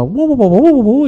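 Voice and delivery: high babbling wu-wu imitating a talkative wife; mock chatter. Pastor imitating a wife talking on and on, high mock babble. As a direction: rattle off nonsense like endless chatter.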